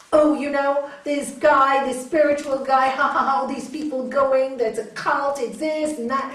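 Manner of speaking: loud, scoffing boatman making fun; jeering ridicule, pitched over the crowd; mocking. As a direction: sneer it out loudly with a mock ha-ha. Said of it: "he was laughing and making fun of Ramakrishna".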